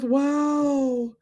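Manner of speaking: ordinary delivery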